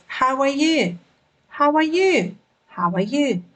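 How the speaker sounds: "How are you?" said as a clear practice model; model phrase. Shown as a demonstration. Teacher gives learners a phrase to imitate, clear model delivery. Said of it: "let's take a phrase".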